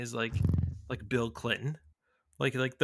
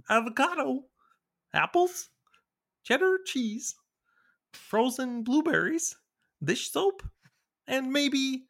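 high, loud puppet-frog voice; Kermit the Frog; 12 semitones higher, 5 dB louder, slower than usual. Shown as a demonstration. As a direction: read each item haltingly in a loud, high frog impression. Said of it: "it could pass as the, the Kermit the Frog".

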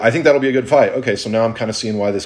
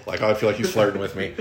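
ordinary talk

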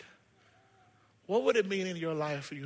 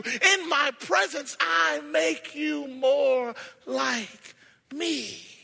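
high, loud, slow stretched preaching; sermon climax with music behind; preaching at full force; 8 semitones higher, 4 dB louder than usual. Acted. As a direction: lift the congregation up. Preaching God's words.

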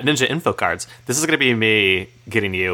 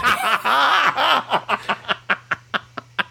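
long laugh. Real, not acted. Laughing at own joke.

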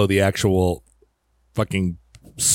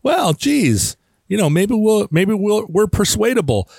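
put-on dopey young pilot; 8 semitones higher than usual. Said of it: "these twenty-three-year-old pilots are like".

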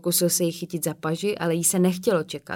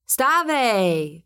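a loud, higher yell; shouted command; 5 semitones higher, 5 dB louder than usual. A narrator's reading. Tom stamping on the coffin, yelling loud and high. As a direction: bellow it down at the coffin. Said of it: "křičel".

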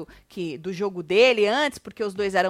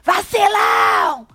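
shouted, the second one higher and slower; mock shout; 5 semitones higher, 6 dB louder, slower than usual. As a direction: yell the insult like he lost it. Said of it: "ele surtou gritando vacilão". (imitando o grito de vacilão)

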